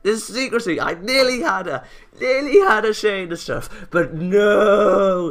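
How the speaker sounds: loud frustrated character voice. Acted. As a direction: rant about the foiled secret. Frustrated character complaining loudly.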